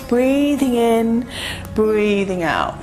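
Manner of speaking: drawn-out, unhurried breath count; slow yoga breathing guidance; slow breathing cue. Real, not acted. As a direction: stretch the words to the length of a breath. Pacing the class's breathing.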